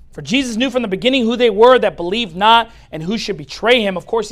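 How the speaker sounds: ordinary talk, nothing marked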